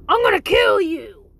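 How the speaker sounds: high, loud roughhousing yell; playful mock threat. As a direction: burst in yelling a play-fight threat. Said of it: "when Hank comes in the house and says".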